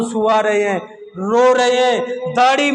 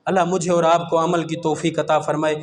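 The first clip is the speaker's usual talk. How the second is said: soft, fast closing prayer; closing dua; 9 semitones lower, 5 dB quieter, faster than usual. Acted. Preacher ending the sermon with a quick soft prayer.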